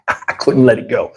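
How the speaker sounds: ordinary delivery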